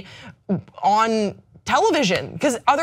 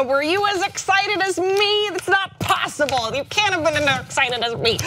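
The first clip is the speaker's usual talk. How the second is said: dog voice; 10 semitones higher than usual. Real, not acted. Host asks viewers about their excitement, in dog voice. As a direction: coo it high like talking to a dog. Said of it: "I'm speaking in my dog voice to our viewers".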